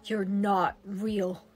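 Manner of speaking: low, hushed, slow fear; frightened whisper. Acted. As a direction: shrink away, whispering. Terrified girl denying the phantom.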